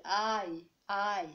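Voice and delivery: open-mouthed "eye" for the letter I; English I. Shown as a demonstration. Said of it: "Avec bouche ouverte". (I à l'anglaise, bouche ouverte)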